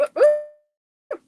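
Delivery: high, loud delighted ooh ooh; sudden excited squeal. Real, not acted. Excited reaction to chat.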